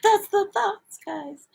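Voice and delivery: very high, squeaky little voice; silly high-pitched repeat; playful high voice. Pretend. Repeats whispered phrase in high voice.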